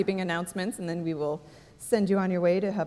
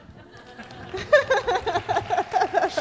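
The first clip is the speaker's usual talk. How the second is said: high burst of laughter; loud laugh; 12 semitones higher, 6 dB louder than usual. Real, not acted. Big laugh at donation reveal.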